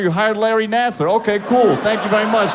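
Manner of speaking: high, exasperated retort; hammed-up outraged sarcasm; mock indignation. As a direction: snap back with sarcastic thanks.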